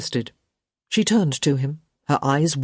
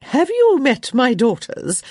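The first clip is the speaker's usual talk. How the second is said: society mother; 9 semitones higher, 5 dB louder, slower than usual. A narrator's reading. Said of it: "clearing her throat a few times before asking".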